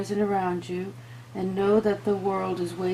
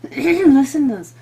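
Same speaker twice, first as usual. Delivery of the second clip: real strained, cracking voice, louder and higher; losing her voice; 7 semitones higher, 12 dB louder than usual. Real, not acted. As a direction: push through a failing voice. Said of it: "I keep losing my voice".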